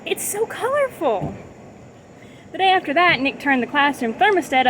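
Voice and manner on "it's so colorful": high, slow, gushing; delighted teacher. A narrator's reading. Teacher praising the colorful classroom, high and delighted. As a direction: clap and gush over the kids' work. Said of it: "clapped her hand and said".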